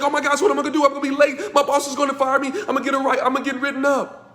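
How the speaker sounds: mock panic while cleaning up spilled coffee; frantic panic. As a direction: fret like you're already late for work. Panicked self-talk after coffee spill.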